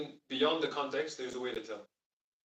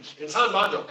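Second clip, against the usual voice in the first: quoting mom; 4 semitones higher, 8 dB louder than usual. Retold or imitated. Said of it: "my mom says all the time".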